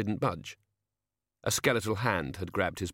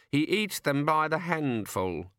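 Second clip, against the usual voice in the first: raised, slow, dismissive remark; 7 semitones higher, slower than usual. A narrator's reading. Senior Wrangler explaining the bursar to the fairy, mildly dismissive. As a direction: toss it off as unimportant, pitch raised. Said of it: "said the senior wrangler dismissively".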